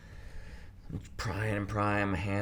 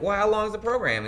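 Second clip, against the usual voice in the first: exasperated question; 13 semitones higher, 7 dB louder than usual. Retold or imitated. Protesting to judge.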